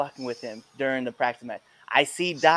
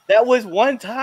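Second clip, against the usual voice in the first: protesting; 9 semitones higher, 9 dB louder than usual. Retold or imitated. Friend protesting.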